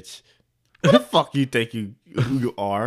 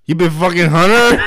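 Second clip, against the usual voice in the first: louder, higher incredulous question; mock shocked question; 4 semitones higher, 5 dB louder than usual. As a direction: blurt it out as a shocked boss. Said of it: "And then Michael be like".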